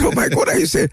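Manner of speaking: high, startled disbelief; husband hearing he was fired, stunned high disbelief; shocked disbelief. Retold or imitated. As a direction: squeak it out in shock. Shocked reaction to firing news.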